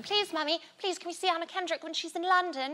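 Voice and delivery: put-on high-pitched begging little kid; pleading child. Daughter begging mummy to meet her idol, high pleading voice.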